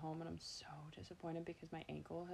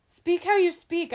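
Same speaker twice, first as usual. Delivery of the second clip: loud, high, slow retold urging; emphatic insistence; 12 semitones higher, 15 dB louder than usual. Guests urging the host to talk freely, loud emphatic insistence. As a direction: belt it out word by word as encouragement.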